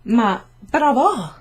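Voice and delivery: slow, loud sarcastic "Ma bravo!"; teacher's sarcastic well done; mock praise. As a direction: praise him while mocking him. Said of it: "la maestra gli dice".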